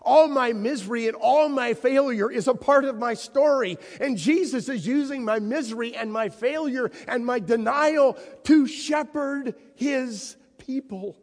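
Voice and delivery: high, loud, building fervour; rising preaching. Acted. Preacher voicing Peter's realization to the congregation, fervent. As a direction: lift it up with conviction.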